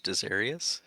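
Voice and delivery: normal talking voice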